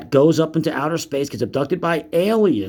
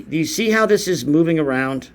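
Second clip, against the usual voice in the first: normal talking voice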